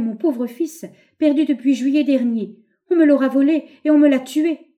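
anguished outcry. A narrator's reading. Mother over her son's body, loud anguished outcry. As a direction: build to a loud, pained protest.

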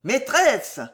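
high-pitched holler for the mistress; bellowed call. A narrator's reading. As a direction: call indoors to summon her. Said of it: "beugla l'homme à la tête rousse". (appel beuglé, voix aiguë)

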